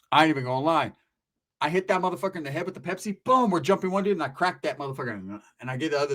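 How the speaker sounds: normal talking voice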